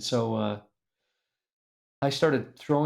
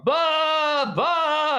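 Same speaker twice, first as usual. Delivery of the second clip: high, bleating "Bah, bah" like a ewe; sheep bleating; 5 dB louder than usual. Retold or imitated. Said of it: "They're going".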